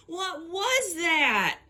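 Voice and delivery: high, drawn-out fake Southern drawl; bad Southern accent, high and falling slowly at the end; terrible Southern accent. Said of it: "[name] has a terrible Southern accent".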